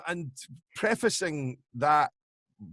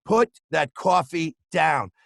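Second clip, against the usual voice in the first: louder, bullying sales-boss rant; Alec Baldwin salesman; 4 dB louder than usual. Retold or imitated. Impressionist shows his early Trump, Glengarry sales-boss tirade. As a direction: tear into the salesmen, slowing on the last order. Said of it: "sounded oddly more like Alec Baldwin in a film".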